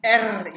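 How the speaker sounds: एर with the tongue vibrating on the R; rolled R. Shown as a demonstration. Teacher showing tongue vibration, एर with rolled R. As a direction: say एर loud and high, trilling the R. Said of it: "जुबान को vibrate करना है".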